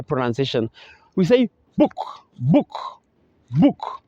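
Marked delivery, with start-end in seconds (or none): 1.8-1.9 book, its double o kept short; a short, quick vowel in book. Shown as a demonstration. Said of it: "good, short". 2.4-4.0 book, short double o, each word set apart; short vowel in book. Shown as a demonstration. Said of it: "good, short".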